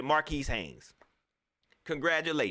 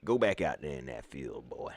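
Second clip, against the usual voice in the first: much lower, brushing the player off; dismissive team voice. Pretend. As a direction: wave him back out to the field, low.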